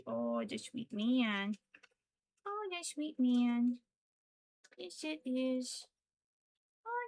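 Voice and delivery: slow, rising cooing praise. Real, not acted. Owner doting on her pet slowly. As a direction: dote on the pet.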